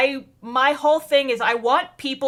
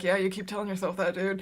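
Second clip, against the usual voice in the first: quiet dry retort; 4 semitones lower, 9 dB quieter than usual. Retold or imitated. Yuki's dry retort voiced.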